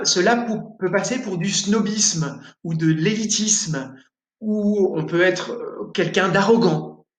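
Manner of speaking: ordinary talk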